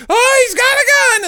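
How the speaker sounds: high, fast mock scream of the crowd; mock panicked cry. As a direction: squeal it like a scared bystander.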